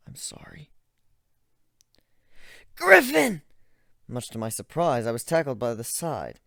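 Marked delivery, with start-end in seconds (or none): 0.1-0.7 soft unvoiced whisper. Ivan whispering an apology to Sarah. 2.8-3.4 high, loud call. Acted. Gilda shouting to stop the narrator.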